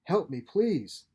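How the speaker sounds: loud, slow, pleading cry; desperate plea. Acted. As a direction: beg for help like a hunted man. Geary acting the victim.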